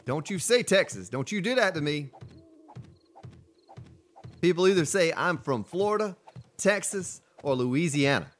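ordinary talk, nothing marked

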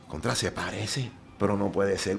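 low, soft self-talk in disbelief; quiet retold inner thought; thinking to himself. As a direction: drop right down, as if privately puzzling it out.

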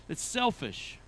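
real short laugh into the words; amused admission. Real, not acted. Preacher answering why we want pure motives, amused admission. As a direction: say it slowly after a chuckle.

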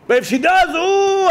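rising mock exasperation; quoting an angry husband, climbing high on ooh; building outburst. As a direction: voice leaps up on ooh. Angry husband retold to rabbi.

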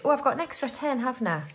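usual speaking voice

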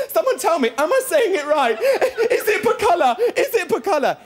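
mum showing off to the crowd; excited mum. Retold or imitated. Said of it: "My mum's there going".

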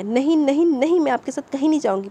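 high, loud protest; wife digging her heels in; insistent refusal. A narrator's reading. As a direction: refuse stubbornly, voice jumping up.

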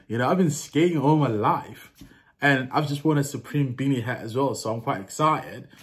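casual put-on American accent. Shown as a demonstration. Vlogger telling viewers about sneakers and skating, put-on American accent. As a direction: tell the story in an American accent. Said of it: "let me do an American accent".

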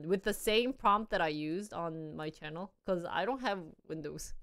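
normal talking voice

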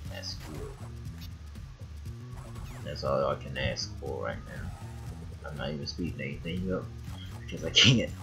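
ordinary talk

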